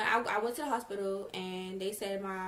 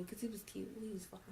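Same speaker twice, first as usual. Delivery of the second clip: soft whisper; whispered aside; 10 dB quieter, faster than usual. Real, not acted. Whispered aside about the boy.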